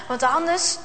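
ordinary delivery

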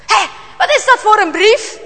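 high, loud, startled outburst; startled demand. A narrator's reading. Father discovers the church letter, startled and loud. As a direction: snap out in alarm at the letter.

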